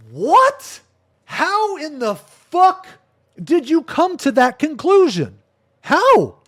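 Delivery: can't believe what he's hearing; stunned disbelief. Real, not acted. Streamer to viewers about a wild accusation, incredulous outburst. Reacting to being called abuser.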